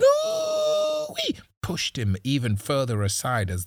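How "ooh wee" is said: piercing high "ooh-wee" scream; women screaming. A narrator's reading. Women screaming as they storm the compound, piercing wail. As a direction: shriek a long alarm cry. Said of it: "Dozens of women screaming".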